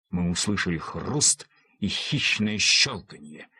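usual speaking voice

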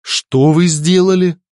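slow, high-pitched shocked question. A narrator's reading. Holy father reacts to Alfred's deed, shocked. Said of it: "в шоке произнёс святой отец".

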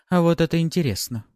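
high, bright with interest; intrigued. A narrator's reading. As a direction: perk up, suddenly interested. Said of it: "чуть ли не присвистнула дамочка".

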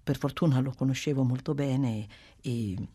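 ordinary delivery

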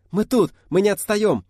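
put-on high, loud girls' shouts; shouted reply. A narrator's reading. Girls assure the leader they follow, high shouting. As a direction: call back eagerly on the run.

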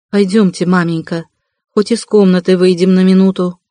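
ordinary delivery

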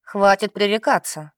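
ordinary delivery

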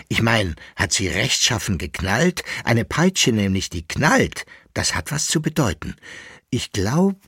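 keen servant, voice climbing; suddenly lively. Acted. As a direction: bubble over about the whip dream. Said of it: "wurde plötzlich recht lebhaft".

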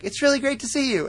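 high, quick gushing hello; mock eager greeting. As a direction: imitate an overeager date. Sokka greets his date, retold.

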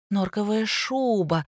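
slow, drawn-out amazement; amazed. A narrator's reading. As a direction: marvel slowly at a luxury. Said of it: "продолжала изумляться завуч".

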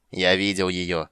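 leering. Acted. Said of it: "осклабился тёмный гном".

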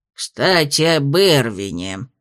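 slow, low, grumbling drone; grumbling tedious voice. A narrator's reading. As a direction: grumble it like a bored old lecturer. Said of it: "самым ворчливым, самым занудным голосом".

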